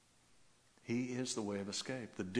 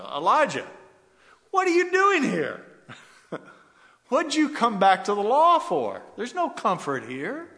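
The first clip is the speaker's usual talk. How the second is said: much higher, loud, cried-out challenge; God's booming voice; 13 semitones higher, 14 dB louder than usual. Acted. God calls out to Elijah in the cave, booming. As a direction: call out like God rebuking the prophet. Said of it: "first thing that the Lord said".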